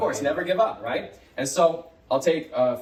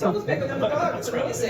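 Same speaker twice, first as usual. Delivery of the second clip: high-pitched gushing wonder; mock amazement; 10 semitones higher than usual. Shown as a demonstration. Teacher hypes up the sandcastle picture, amazed. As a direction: gush over it, pitched way up.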